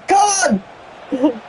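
high, drawn out come on; urging yell. Real, not acted. Gamer urging his teammate on, high yell. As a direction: yell at the screen to push it on.

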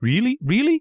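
very high, joyful puppet voice; overjoyed. A narrator's reading. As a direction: burst out with delighted disbelief. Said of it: "jumping around with joy".

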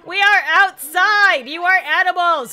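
exasperated pleading. Real, not acted. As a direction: beg the cats, fed up. Pleading with cats.

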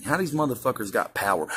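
ordinary delivery

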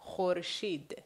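ordinary talk, nothing marked

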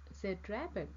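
ordinary delivery, nothing marked

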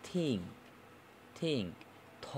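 "থিঙ্ক" opening on voiceless th; theta sound demo. Shown as a demonstration. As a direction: model the th of "থিঙ্ক" like Bengali থ. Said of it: "বাংলায় আমরা যেটাকে থ উচ্চারণ করি".

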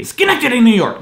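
high, loud thunder shout; thunder sound. Shown as a demonstration. Third thunder try, loud Schenectady shout. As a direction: boom it like thunder. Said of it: "I'll do it differently".

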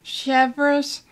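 usual speaking voice

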